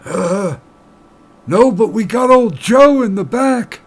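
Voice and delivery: put-on high, loud, slow old-timer bartender. Bartender telling the miner about old Joe, high and slow. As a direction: drawl it out like an old barkeep.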